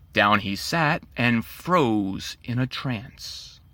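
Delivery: ordinary delivery, nothing marked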